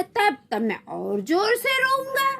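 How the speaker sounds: put-on high-pitched childish wail; defiant child. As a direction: threaten it like a bawling little boy. Child threatens to cry louder.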